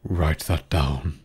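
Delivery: king's order. A narrator's reading. King orders the jury.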